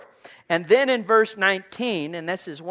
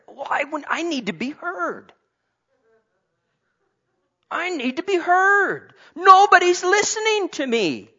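much higher and louder, pleading to be heard; whiny mock complainer; 11 semitones higher, 8 dB louder than usual. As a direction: mimic a self-pitying complainer. Mocking a whiny self-centered person.

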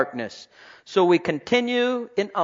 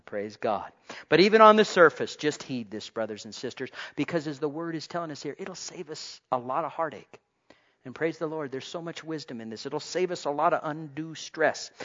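ordinary talk

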